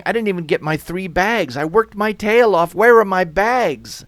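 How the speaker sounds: high, loud, aggrieved protest; indignant complaining. Acted. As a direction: complain loudly, building to a shout. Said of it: "skyscrapers complaining".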